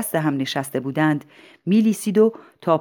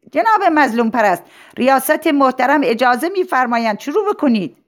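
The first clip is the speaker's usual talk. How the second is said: high, raised voice from the audience; formal prompt called across a hall; calling out; 8 semitones higher, 4 dB louder than usual. Acted. Audience man calls out to speaker. (صدای بلند و رسمی از میان حضار)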